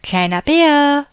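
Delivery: loud high call across the rocks. A narrator's reading. Big bear calling little bear from the cave, loud call. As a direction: call out for him, searching. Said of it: "rief der große Bär".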